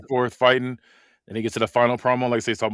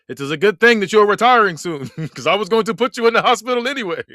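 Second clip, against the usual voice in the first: Gunther voice; 8 semitones higher, 8 dB louder than usual. Acted. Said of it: "you don't sound German at all".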